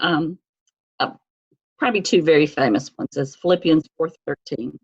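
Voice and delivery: normal talking voice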